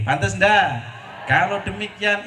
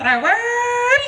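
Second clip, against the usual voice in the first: very high, drawn-out comic squeal; mock nagging; 4 dB louder than usual. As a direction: stretch it out high, mimicking fussiness. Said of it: "Seorang istri orangnya bawel".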